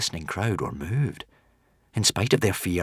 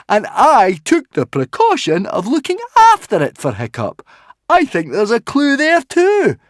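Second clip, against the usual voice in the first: high, loud character voice; insistent Fishlegs; 11 dB louder than usual. Acted. Fishlegs kept the will for Hiccup, high and insistent. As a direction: press the point, loud and high. Fishlegs presents evidence to the crowd.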